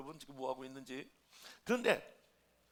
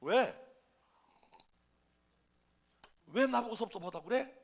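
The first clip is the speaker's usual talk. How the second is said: loud, raised-pitch retold startle; startled; 5 semitones higher, 10 dB louder than usual. Pastor hears a member is hurt by him, startled question. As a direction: ask it taken aback, acting out your own surprise. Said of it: "깜짝 놀라가지고".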